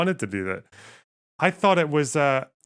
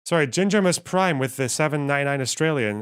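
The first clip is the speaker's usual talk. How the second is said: normal talking voice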